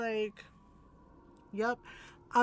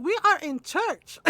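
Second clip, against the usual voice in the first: higher, emphatic mock reprimand; scandalised scolding; 4 semitones higher, 4 dB louder than usual. Mom scolding tito in church, acted outrage. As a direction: imitate an appalled mother telling someone off.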